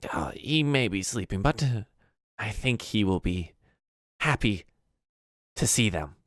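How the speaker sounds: lightly voiced elderly goblin, halting; a hint of old goblin crone; old goblin woman. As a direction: pause between phrases as an old goblin. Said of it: "the goblin responds to her".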